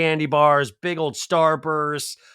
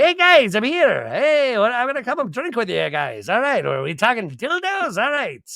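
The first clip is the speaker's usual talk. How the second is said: hammed-up arrival; 8 semitones higher than usual. Paulie barging in on guys.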